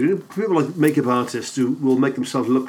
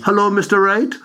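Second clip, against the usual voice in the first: West Indian lilt; 5 semitones higher than usual. Retold or imitated. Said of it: "a slight West Indian lilt".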